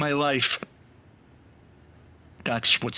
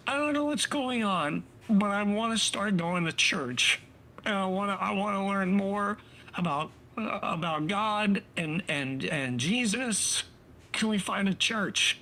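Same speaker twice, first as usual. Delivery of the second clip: mock wife voice; 8 semitones higher than usual. Wife's quote voiced high.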